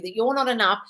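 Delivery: usual speaking voice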